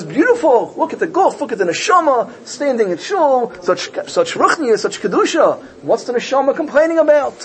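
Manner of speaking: high-pitched, delighted wonder; acted amazement turning to high, puzzled questioning; marveling admiration. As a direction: marvel aloud, drawing out the praise. Hashem admires, doubts the complaint.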